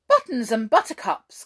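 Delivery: loud astonished exclamation; astonished gasp. A narrator's reading. Hop astonished the watch vanished, loud exclamation. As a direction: gasp it out, amazed. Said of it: "gasped Hop in astonishment".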